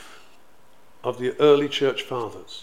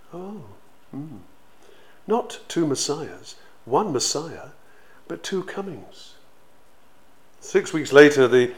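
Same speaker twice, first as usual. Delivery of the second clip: normal talking voice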